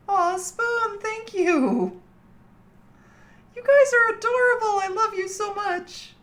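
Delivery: overwhelmed by how adorable chat is; touched squeal. Real, not acted. Streamer squealing her thanks and love to Spoon.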